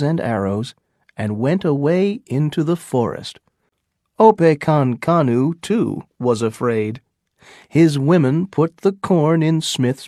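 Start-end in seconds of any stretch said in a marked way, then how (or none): none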